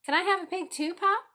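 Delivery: high, loud, excited asking; eager boy. A narrator's reading. Boy begs father.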